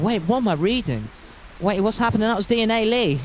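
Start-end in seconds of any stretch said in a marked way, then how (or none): none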